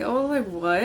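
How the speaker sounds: usual speaking voice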